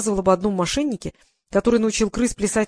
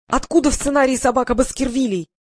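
high, agitated protest; narrated yell of indignation; straining holler; 5 semitones higher, 4 dB louder than usual. A narrator's reading. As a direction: demand it in a raised, straining pitch. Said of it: "надрывался Вадим".

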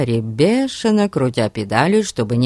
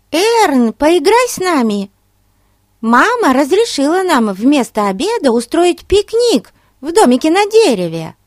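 put-on high, eager little girl; 12 semitones higher, 5 dB louder than usual. A narrator's reading. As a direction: beg to play like a child. Little girls beg Ern.

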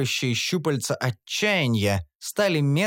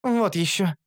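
higher, scoffing; dismissive snort; 5 semitones higher than usual. A narrator's reading. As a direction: brush it off with a snort. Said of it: "фыркнула Карамелька". (фыркает пренебрежительно)

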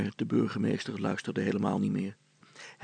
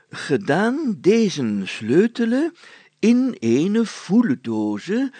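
slow, loud archaic declaiming; solemn reading; 6 semitones higher, 9 dB louder, much slower than usual. A narrator's reading. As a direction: intone each word as ancient writ. Mayor reads old document. (plechtig voorlezen)

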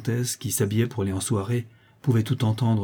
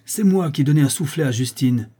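put-on loud, high childish declaration; cried out; 5 semitones higher, 5 dB louder than usual. Boy owning up despite his cousin, crying out. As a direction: call it out boldly over the others. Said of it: "cria Dimitri".

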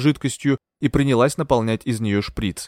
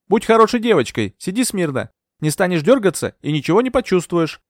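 lightly voiced nurse, raised in pitch; higher-pitched, condescending instruction; patronising nurse; 7 semitones higher, 4 dB louder than usual. A narrator's reading. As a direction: coax a patient like a little girl. Nurse orders patient before injection.

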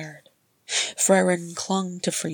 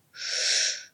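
hushing; 3 dB quieter than usual. A narrator's reading. Thorin hushing weeping friend.

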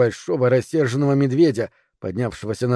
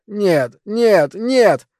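high, louder wail of denial; anguished moan; 7 semitones higher, 4 dB louder, much slower than usual. A narrator's reading. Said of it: "донёсся стон Финча".

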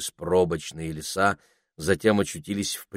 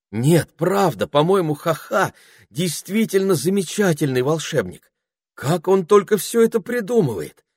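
put-on high, breathless admiring girl; delighted girl; 11 semitones higher, 5 dB louder than usual. A narrator's reading. Penelope admiring the wizard's inventions, high and thrilled. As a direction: gush with wonder.

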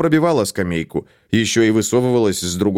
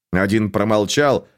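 plain reading voice

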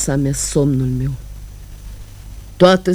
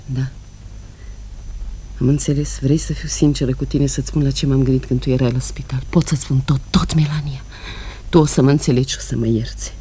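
lower, soft, intimate tone; sincere confession; 6 semitones lower, 4 dB quieter, faster than usual. Acted. Woman confessing to her sister, soft and sincere. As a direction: confide it gently.

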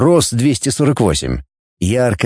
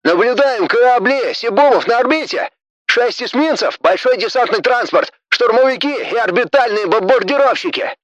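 officer report; 10 semitones higher than usual. Acted.

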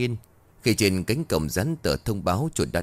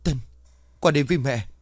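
shout; 6 semitones higher, faster than usual. A narrator's reading. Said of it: "À liền hét lớn".

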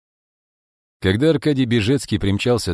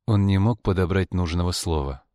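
ordinary delivery, nothing marked

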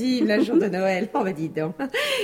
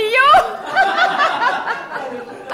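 long, high, louder laughter; gleeful laugh; 13 semitones higher, 5 dB louder than usual. Acted. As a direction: laugh off the whole scene. Gleeful mockery after landlord leaves.

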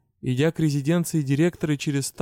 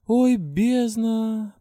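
high, slow, drawn-out groan; moaning; 8 semitones higher, much slower than usual. A narrator's reading. Heroine groans at the bad news, high drawn-out moan. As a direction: moan "Ой, бездна!" high and long. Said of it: "простонала я".

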